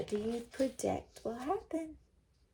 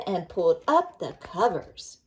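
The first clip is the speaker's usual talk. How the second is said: ordinary delivery, nothing marked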